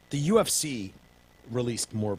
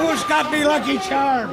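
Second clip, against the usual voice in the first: Irish leprechaun voice, high and loud; hammed-up Irish leprechaun; 8 dB louder than usual. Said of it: "Crazy little leprechaun".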